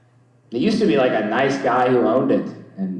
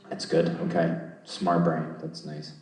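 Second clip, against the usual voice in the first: usual speaking voice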